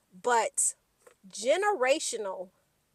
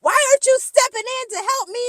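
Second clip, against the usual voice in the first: high, loud, rapid retold yell; imitated yell; 13 semitones higher, 10 dB louder, much faster than usual. Dorit yelling at Kyle for not helping, imitated shouting. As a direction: yell it fast and high, mimicking an outburst. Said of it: "then she yells at Kyle".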